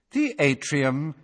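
cool, slow announcing voice; calm lift announcer; cool female voice. A narrator's reading. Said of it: "said the cool female voice".

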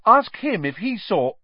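high, urgent cut-off plea. Acted. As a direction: press it before interrupted. Boy points shaking finger.

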